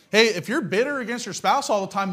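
normal talking voice

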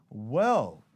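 drawn-out, pondering "well"; mock hesitant. People answering an open parenting question, mock hesitant. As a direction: imitate someone hedging their answer.

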